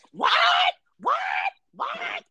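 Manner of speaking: shocked mom, rising high on the last What; scandalized mother catching her son cussing; Christmas Story mom. Retold or imitated. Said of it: "the mom off of, um, A Christmas Story".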